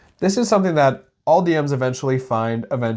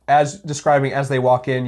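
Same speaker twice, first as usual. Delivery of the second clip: normal talking voice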